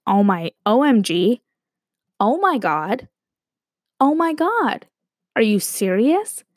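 hammed-up disbelief, pitch swooping up; mock shock. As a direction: react as if stunned by huge news. Acting out JFK's shocked reaction.

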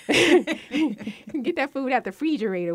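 lightly put-on Southern accent on refrigerator; friend's accent. Host imitating a guest's accent on air, laughing. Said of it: "I'm picking up my friend's accent".

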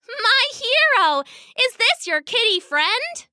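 very high little-princess voice; delighted little girl. A narrator's reading. Princess catching up to both cats, delighted.

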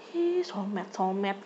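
soft mocking sing-song; hushed teasing echo. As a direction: tease the word under your breath. (ejekan pelan berbisik)

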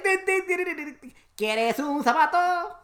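high, silly clown sing-song, laughing. Pretend. Teasing his brother as a clown, silly high voice. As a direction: play a goofy circus clown. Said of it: "It's like".

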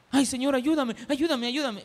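high, mock-desperate pleading; hammed-up prayer for help. Acts out a person begging God.

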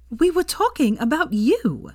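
a hint of Hermione setting it straight; lightly voiced Hermione.

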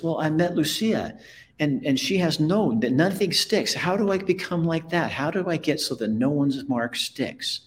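high, earnest character voice; pleading little toy. Acted. Punchinella asks toy maker how to become like Lucia, pleading. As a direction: pitch it high, pleading for an answer.

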